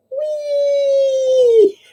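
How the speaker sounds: long high-pitched squeal; gleeful slide whoop. Acted. Acting man whooping down slide.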